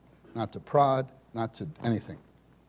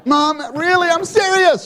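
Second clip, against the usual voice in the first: put-on high, loud childish plea; pleading kids; 13 dB louder, slower than usual. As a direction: beg like a kid who means it.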